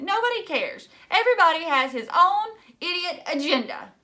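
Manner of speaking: high, loud and bitter; upset outburst. A narrator's reading. Phoebe lashing out at her father, high and loud. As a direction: lash out at everyone.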